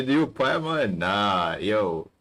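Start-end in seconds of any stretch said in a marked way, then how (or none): none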